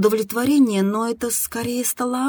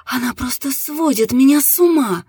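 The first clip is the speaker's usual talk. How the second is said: higher-pitched, heightened moan; 6 semitones higher than usual. A narrator's reading. Woman answering her partner's question, high moan. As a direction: cry it out high, moaning. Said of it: "застонала женщина".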